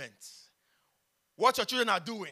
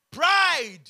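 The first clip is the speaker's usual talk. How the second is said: emphatic shout; 6 semitones higher, 10 dB louder than usual. Real, not acted. Preaching climax, word hammered.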